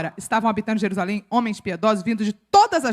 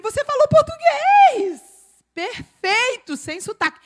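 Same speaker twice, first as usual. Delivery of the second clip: high, drawn-out amazed exclamation; astonished translator; 13 semitones higher, 5 dB louder, slower than usual. Retold or imitated. Translator tells the preacher what happened, astonished. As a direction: stretch it out in high-pitched disbelief. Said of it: "a menina da tradução falou pra ele".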